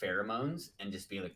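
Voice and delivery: ordinary delivery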